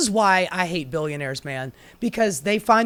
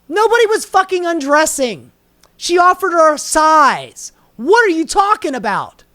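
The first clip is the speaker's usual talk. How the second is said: furious shout; 11 semitones higher, 10 dB louder than usual. Real, not acted. Angry retort to the quoted author.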